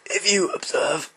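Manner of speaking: hushed, slow nature documentary voice; David Attenborough impression. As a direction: narrate like a wildlife documentary. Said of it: "I'll do my David Attenborough".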